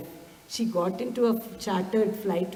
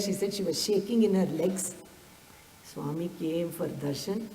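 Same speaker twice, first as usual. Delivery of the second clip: ordinary talk, nothing marked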